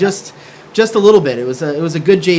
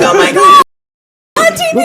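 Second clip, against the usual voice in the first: much higher, louder thrilled squeal; excited squeal; 10 dB louder, faster than usual. Retold or imitated. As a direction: squeal like family on TV. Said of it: "They're like".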